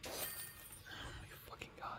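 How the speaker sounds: breathless whispered curse; under the breath, stunned; whispered oh my God. Real, not acted. Streamer whispering at the filthy object. Shocked at the dirt.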